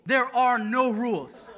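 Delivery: There are no rules stretched out slowly and loudly with a laugh; grand slow declaration. Retold or imitated. Bus driver announcing to kids, slow laughing proclamation. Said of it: "here's the new rule".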